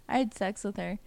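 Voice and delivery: mock smug, on the soft side; understated imitation of a self-satisfied brag. Imitating him after sex with her, softly smug. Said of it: "smug. He's like".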